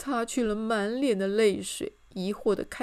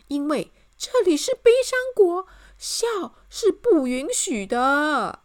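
tearful wailing; 9 semitones higher, 9 dB louder than usual. A narrator's reading. King explains the rule of Sorrow Land, bawling. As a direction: bawl the explanation. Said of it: "哭得稀里哗啦地说".

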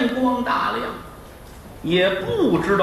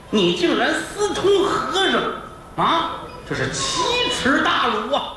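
high, ranting outrage; hammed-up wronged husband; mock fury, stretched out; raging husband; 8 semitones higher than usual. Husband rages at wife.

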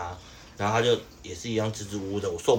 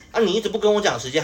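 higher, louder mock snapping back; mock retort; 10 semitones higher, 5 dB louder than usual. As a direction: imitate her snapping back at you. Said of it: "反呛我".

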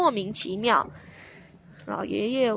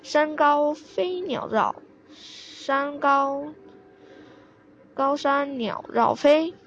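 slow, drawn-out syllables, mostly pitched up; poem recitation; 4 semitones higher, slower than usual. A narrator's reading. Old man recites rotating poem.

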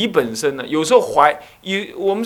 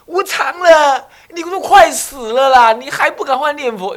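mock shout; 8 semitones higher, 7 dB louder than usual. Monk shouting death warnings at his parents, loud mock yell. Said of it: "然后你还讲".

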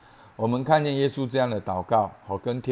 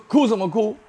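mock scolding; 12 semitones higher, 9 dB louder than usual. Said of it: "在你哭的时候还骂你说".

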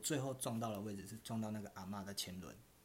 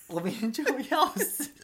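high, slow, laughing; half-laughing in disbelief; laughing exasperation; 12 semitones higher, 10 dB louder than usual. Real, not acted. Laughing at absurd moment. (边笑边无奈)

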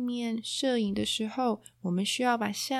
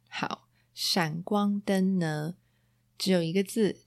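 normal talking voice

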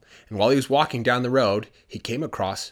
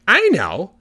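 higher, louder burst of discovery; excited realization; 9 semitones higher, 10 dB louder than usual. Acted. Hans hearing the answer: excited outburst. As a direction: pop it out like a lightbulb moment. Hans's sudden idea.

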